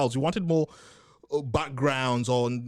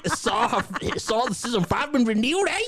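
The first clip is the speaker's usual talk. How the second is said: higher, playful Aussie soap voice; mock Australian accent; 8 semitones higher than usual. Joking about renewal news, in a mock Australian accent. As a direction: ham up an Aussie soap character. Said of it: "your Australian accent".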